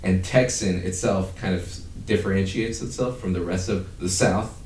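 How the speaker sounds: ordinary talk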